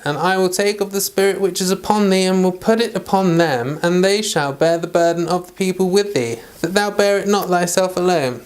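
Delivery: ordinary talk, nothing marked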